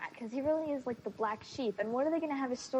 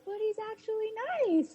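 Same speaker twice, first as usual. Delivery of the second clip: high, girlish mock Caroline defending Klaus; 12 semitones higher than usual. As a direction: pitch it way up, all sweet insistence. Caroline voiced in imagined scene.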